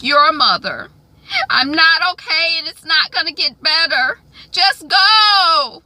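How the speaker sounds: high, loud acted meltdown; distraught outburst. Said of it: "my acting is so good".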